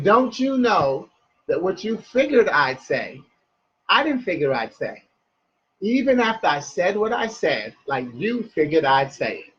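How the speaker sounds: measured phrases, a pause after each; slowed-down poem line, falling at the close. Shown as a demonstration. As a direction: lay the riddle out clause by clause. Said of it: "I'll say it in a slow way".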